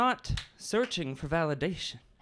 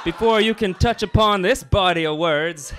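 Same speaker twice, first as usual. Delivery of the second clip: mock shout; 3 semitones higher, 9 dB louder than usual. Comic poem climax, shouted.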